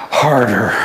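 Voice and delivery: low, deadpan one-word answer. Retold or imitated. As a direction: imitate a young man's deadpan reply. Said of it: "with this deadpan face".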